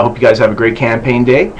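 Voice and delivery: ordinary delivery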